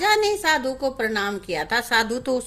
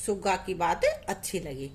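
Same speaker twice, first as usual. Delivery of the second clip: ordinary delivery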